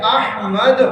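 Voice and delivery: احمد as "AH-mad", h fully sounded; correct pronunciation. Shown as a demonstration. Teacher showing class the name, correct pronunciation. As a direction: open first "a" and clear h in احمد. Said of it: "احمد ہے صحیح تلفظ".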